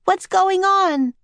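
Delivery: high, slow drawn-out yell. A narrator's reading. Annie demanding to know what's happening, high yell. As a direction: call out the question high and stretched. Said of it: "Annie yelled".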